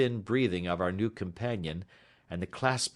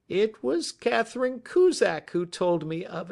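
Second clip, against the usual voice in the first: high, cracking nervous voice; high voice of a frightened man, cracking; 13 semitones higher, 4 dB louder than usual. A narrator's reading. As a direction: let the voice break as he admits it. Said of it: "in a cracking voice".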